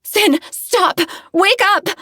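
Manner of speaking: high loud yell. Acted. Narrator yells at screaming Sin to wake, high loud yell. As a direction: shout to wake him up. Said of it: "I yell back at him".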